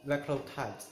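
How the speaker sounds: normal talking voice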